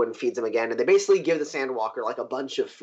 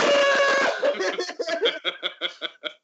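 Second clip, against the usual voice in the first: shouted laugh. Real, not acted. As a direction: laugh out like a cheering crowd. Crowd cheers the grab.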